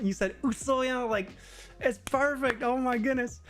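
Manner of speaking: high-pitched, over-the-top mock delight; gushing praise. Locals praising a foreigner's Kansai dialect, gushing. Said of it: "they're so supportive of, like, foreigners".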